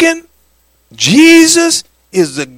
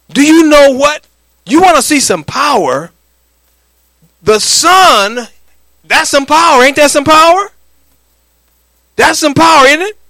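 fervent preaching; 6 semitones higher than usual. Acted. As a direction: preach it, lift them up. Preaching at full force.

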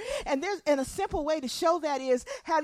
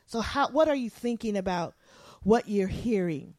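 ordinary delivery

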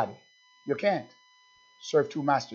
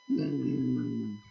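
mock reluctant hum; 4 semitones lower, 6 dB quieter than usual. Mimics reluctant churchgoer refusing.

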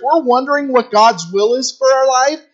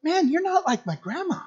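a lightly voiced kid, higher and softer; curious kid; 8 semitones higher, 4 dB quieter than usual. Schoolkid asking the volunteer grandma, curious. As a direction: ask it like a puzzled child. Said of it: "they'll go".

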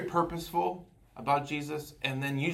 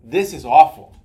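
a sudden loud, harsh rebuke; 15 dB louder than usual. Retold or imitated. Jesus rebuking a failing church, loud and harsh. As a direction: bark it out like a stern reproach. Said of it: "really harshly".

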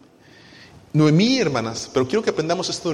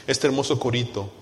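ordinary talk

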